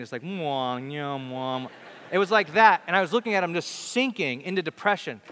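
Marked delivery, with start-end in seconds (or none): 0.2-1.7 imitating a droning speaker's mumble; lazy mouth-moving babble, half-hearted; mock blah-blah drone; mumbling gibberish. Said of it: "can't make out what they're saying".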